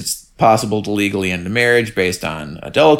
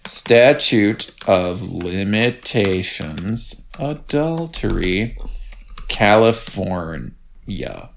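very slow, low, drawn-out search query; softly stretched syllables, pausing between terms; typing aloud; 4 semitones lower, 5 dB quieter, much slower than usual. Real, not acted. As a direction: sound it out slowly as you type.

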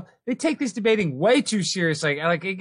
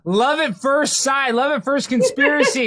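playful exclaim; 8 semitones higher, 5 dB louder, slower than usual. Acted. Host teases couple.

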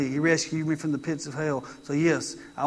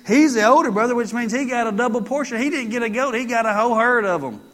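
full-force preaching; 4 semitones higher, 5 dB louder than usual. Real, not acted.

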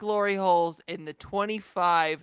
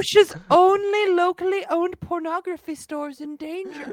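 mocking scared old man; 12 semitones higher, 3 dB louder than usual. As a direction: ham up a frightened old man. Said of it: "I'm making fun of his voice".

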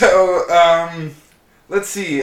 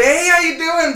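higher, louder forced-cheerful hello; fake-smile awkward greeting; 13 semitones higher, 6 dB louder than usual. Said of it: "Put a kind of fake smile on my face like this is awkward".

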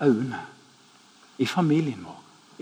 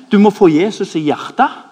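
«Du må få Jesus i hjertet» thrown back loud and higher in disbelief; mocking echo; 5 semitones higher, 8 dB louder than usual. Preacher mocking friend's line to the angry guard, loud disbelief. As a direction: quote him back, scandalised and loud. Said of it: "kulminerer du hele katastrofen med".

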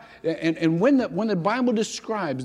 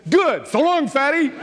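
cheeky parting shot; 10 semitones higher, 6 dB louder than usual. Retold or imitated. Clerk mocks unknowing chairman.

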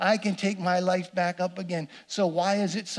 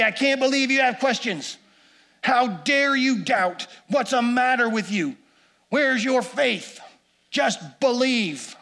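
mock scolding; 4 semitones higher, 4 dB louder than usual. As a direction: play the harsh rebuke Jesus never gave. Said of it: "No, he doesn't say that at all".

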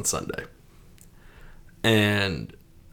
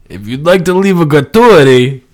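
mock tip request, louder and higher; imitating the locksmith; 4 semitones higher, 8 dB louder than usual. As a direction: act out the locksmith angling for a tip. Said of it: "That's how he said it".

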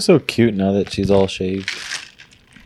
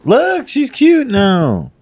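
high, slow, drawn-out cooing; cutesy gushing; 12 semitones higher, 3 dB louder, much slower than usual. Real, not acted. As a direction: gush over an adorable pet. Gushing over the returning pet.

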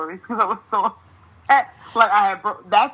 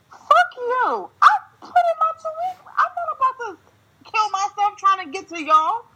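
angry outburst. Retold or imitated. Venting at her job over the phone, high furious shout. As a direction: blow up at the boss.

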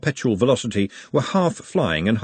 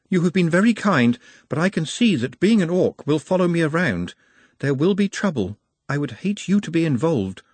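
careful, higher-pitched earnest voice; regretful, polite warning to a kind friend; apologetic Nut; 6 semitones higher than usual. Acted. Nut warns Glenda of trouble, apologetic.

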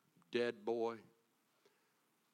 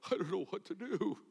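high, louder whiny helpless parent; 7 semitones higher, 4 dB louder than usual. Retold or imitated. Parent giving in to a brat, whiny. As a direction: whine it like a caving parent. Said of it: "If you go".

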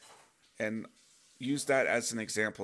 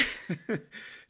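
relieved laugh; 8 semitones higher than usual. Real, not acted. Laughs after realization.

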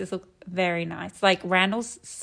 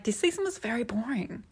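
whisper; 2 semitones higher, 6 dB quieter than usual. Retold or imitated. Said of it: "I'm like".

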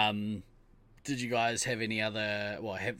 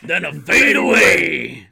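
huge, high, drawn-out bellow; hammed-up villain's climax; shouted film quote; 8 semitones higher, 18 dB louder, much slower than usual. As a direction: roar it slowly to a big finish.

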